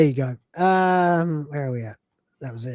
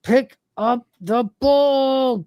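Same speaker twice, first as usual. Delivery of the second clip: very slow, higher, drawn-out command; straining command; 8 semitones higher, 3 dB louder, much slower than usual. Retold or imitated. Lister willing the stubborn arm to obey, straining command. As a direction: stretch every word out with mounting effort.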